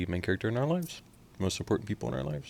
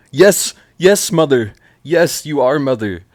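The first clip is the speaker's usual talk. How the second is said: loud high gushing yes; loud high fan hype; excited hype; 7 semitones higher, 15 dB louder, slower than usual. Real, not acted. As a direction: cheer her on loudly. Hyping the lyric in excitement.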